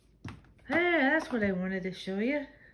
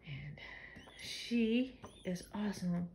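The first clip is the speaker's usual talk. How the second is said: whisper; 4 semitones lower, 6 dB quieter, slower than usual. Real, not acted. Aside whispered about the cat.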